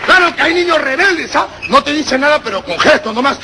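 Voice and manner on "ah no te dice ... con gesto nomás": ordinary talk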